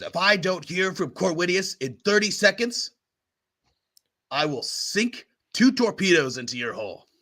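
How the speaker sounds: lightly acted irate captain; mildly heated ultimatum; spluttering threat. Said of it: "spluttering on the other end".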